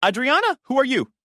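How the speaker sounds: high, loud, alarmed; anxious. Acted. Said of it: "answered anxiously".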